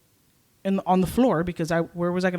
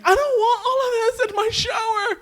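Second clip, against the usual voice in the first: very high crying protest; distressed wail. Retold or imitated. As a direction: wail it in hammed-up despair.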